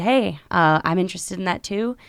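ordinary delivery

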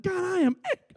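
high, cracking start of a confession; a high, halting mock-confession; honest confession. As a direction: blurt out the admission, then cut off. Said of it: "And one time I said".

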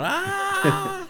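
pained yelp. Retold or imitated. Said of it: "you're just cleaning ... going".

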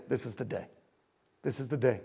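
very soft, low pronouncement; God's quiet assurance. Acted. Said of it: "And God goes".

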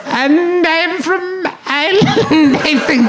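high-pitched, drawn-out bad Irish; slow, squeaky mock-Irish accent. Shown as a demonstration. Comedian demonstrating Dervla Kirwan to friend, hammed-up Irish accent. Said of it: "Can you do an Irish accent?".

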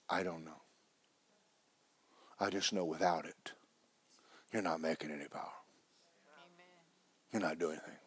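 soft unvoiced confiding; a near-silent whispered answer; hushed whisper. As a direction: lean in and whisper it.

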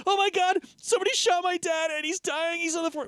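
high frantic 911 caller; panicked caller. Retold or imitated. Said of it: "they're like".

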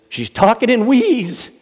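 high, drawn-out alarmed cry; mock alarm. Runner bursts back in to report on the woman, alarmed. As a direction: report it urgently, voice shooting up. Acted panicked report to leader.

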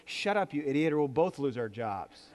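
ordinary talk, nothing marked

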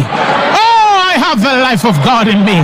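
soaring preacher's confession, quickening after the "Oh"; exultant preaching. Acted. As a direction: proclaim it to the whole congregation. Preaches a declaration of faith.

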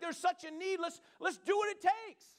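rally them eagerly. Retold or imitated. Someone urging the others to act, high and eager. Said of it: "It's like".

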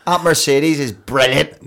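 broad Northern Irish. Retold or imitated. Mocking a Northern Irish Mercedes advert, hammed-up Northern Irish. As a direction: lean into a thick Northern Irish pitch. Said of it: "Or they don't want".

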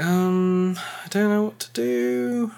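imitating her unsure beginner self at the table; timid newbie. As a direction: sound like the nervous new player in the group. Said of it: "always the one that's like".

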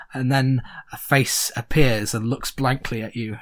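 normal talking voice

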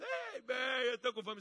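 high, mock child's wail; crying child. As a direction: lightly imitate a hungry child crying at table. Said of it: "fica com um prato na mesa e chorando".

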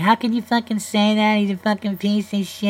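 high-pitched mock outrage, imitating women critics; mock angry women. Talk-show host mocking angry women critics. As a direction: sneer it back like a scolding critic. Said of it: "I know women are like".

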